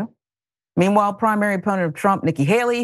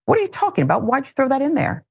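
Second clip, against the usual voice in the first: ordinary talk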